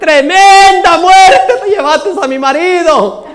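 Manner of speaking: loud, high, drawn-out lament; widow's mock wail. Widow cries out at the thieving cat, loud mock wail. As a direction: howl it slowly with hammed-up grief. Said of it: "pegaba a la mujer el grito".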